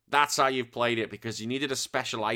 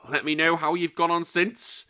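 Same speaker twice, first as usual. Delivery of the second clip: ordinary talk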